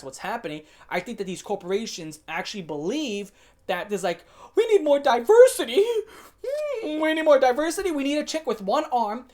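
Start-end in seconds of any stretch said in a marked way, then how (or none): 4.5-9.3 high, loud mock-executive whine; mocking demand. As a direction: caricature a frantic corporate demand. Mocking corporations demanding diversity.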